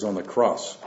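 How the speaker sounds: ordinary talk, nothing marked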